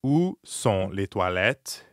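slow, low, word-by-word French question; slow model phrase. Shown as a demonstration. Teacher models the bathroom question for beginners, slowly. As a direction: space out each word for a beginner, pitched low.

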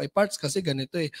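steady and reassuring, no stammer; calm answer to an upset reseller; calm reply. Shown as a demonstration. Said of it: "Anong papaliwanag mo?".

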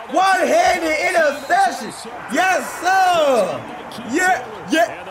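high, excited celebration; triumphant shout. Real, not acted. Gamer celebrating a one-handed interception, triumphant shout. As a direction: holler out the big play.

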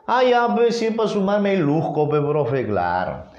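put-on a kid bragging he'll shine at adding; teacher playing a pupil keen on the sum; eager pupil.

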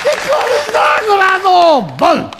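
high, loud burst of excitement; sudden loud shout. Pretend. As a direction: blurt it out loud at the climax. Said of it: "いきなり大きな声を出して". (思わず大声で叫ぶ)

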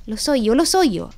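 high, loud and eager; excited little girl calling out the answer; eager exclamation. A narrator's reading. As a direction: blurt it out like a child who knows. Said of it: "esclamò Carol".

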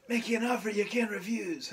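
put-on Godfather-style Italian accent; raspy Italian mobster accent, retried. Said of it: "it's more like".

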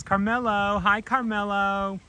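high-pitched pet-talk; greeting a pet. Real, not acted. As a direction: coo a high hello to an animal. Greeting an approaching animal.

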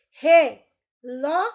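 "Relógio" split into re, ló, gio with long pauses; "Relógio" broken up for dictation; syllable by syllable. Shown as a demonstration. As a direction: say "Relógio" loudly, one slow syllable at a time. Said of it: "Separe as sílabas das palavras".

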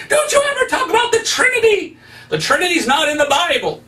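high-pitched imitation of an irate caller; mock screaming. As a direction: screech it, imitating an outraged phone caller. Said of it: "a guy screaming at me on the phone".

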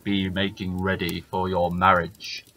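slow, booming giant; lumbering giant speaking slowly. Acted. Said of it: "says the giant".

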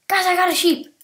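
excited call. Real, not acted. Calling team in game.

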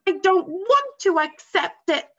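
put-on high, loud, slow boy's shout. A narrator's reading. Boy shouting at his father, loud and high. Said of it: "shouted Bruno".

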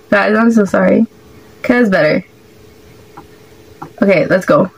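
normal talking voice

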